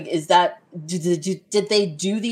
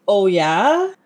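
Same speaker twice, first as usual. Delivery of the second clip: a mock Fargo-accent "Oh, yeah?", somewhat louder; 5 dB louder than usual. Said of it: "my favorite was the".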